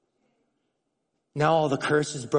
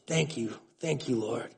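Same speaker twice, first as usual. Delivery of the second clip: hushed thanks; 4 semitones lower, 9 dB quieter than usual. Retold or imitated. Quiet thanks to God.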